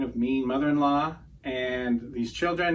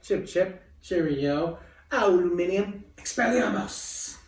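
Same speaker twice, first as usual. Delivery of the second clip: lightly put-on British accent, a bit higher; playful hint of a British accent; jokey British accent parody; mock British accent; 6 semitones higher than usual. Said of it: "I love British accents".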